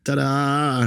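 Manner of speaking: high, drawn-out flourish; triumphant ta-da. Retold or imitated. Tiler unveiling his finished wall, triumphant. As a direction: present it like a grand reveal.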